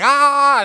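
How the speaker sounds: sudden high scream. Retold or imitated. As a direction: let out a startled yell. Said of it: "I was all like".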